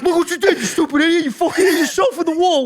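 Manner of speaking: high-pitched imitated scolding; mocking retold taunt. Ronnie taunting Mike after the headbutt, mock scornful. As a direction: jeer at him for his own blunder.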